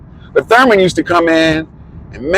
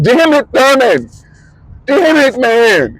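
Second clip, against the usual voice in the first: exasperated outburst; 11 semitones higher, slower than usual. Retold or imitated. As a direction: blurt it out, laughing in disbelief. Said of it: "I lost it on him. I said".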